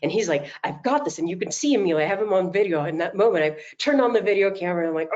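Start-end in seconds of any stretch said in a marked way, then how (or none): 0.6-1.2 put-on high, proud little-boy voice on "I've got this"; little boy repeating. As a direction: mimic a small child echoing an affirmation. Grandson repeats after her.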